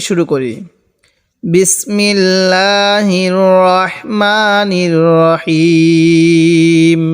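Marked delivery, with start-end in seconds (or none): 1.4-7.0 slow, drawn-out chanted basmala; Quranic recitation. Acted. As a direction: recite it slowly like tajwid. (ধীর তিলাওয়াত)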